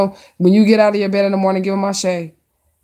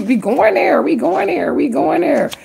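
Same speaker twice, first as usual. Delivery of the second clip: excited; 7 semitones higher than usual. Real, not acted. Excited card reading.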